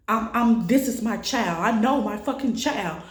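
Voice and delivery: pushing back through tears; retold tearful plea. To the paramedics, a retold tearful desperate plea. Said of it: "I'm crying".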